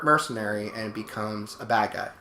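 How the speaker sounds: ordinary delivery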